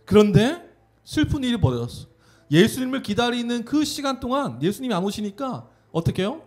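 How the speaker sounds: ordinary talk, nothing marked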